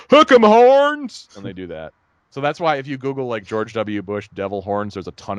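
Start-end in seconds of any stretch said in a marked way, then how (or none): 0.1-1.3 drawn-out high yelled cheer; slow booming football chant; Texas fan cheer. Retold or imitated. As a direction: belt it like a stadium fan. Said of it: "And they say".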